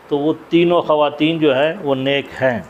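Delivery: ordinary delivery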